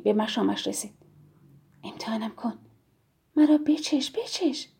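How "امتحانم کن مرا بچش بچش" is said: seductive whisper, then rising; tempting whisper. Pretend. The chocolate luring the man, teasing whisper. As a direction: coax him in a whisper.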